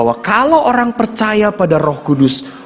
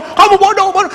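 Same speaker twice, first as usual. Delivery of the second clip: high, mock berating voice; imitated scolding father; 11 semitones higher than usual. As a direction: snap it high like an exasperated dad. Imitating father calling child stupid. (menirukan bapak memarahi anak)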